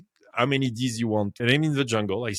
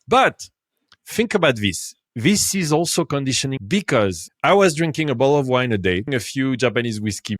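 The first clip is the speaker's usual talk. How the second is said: ordinary talk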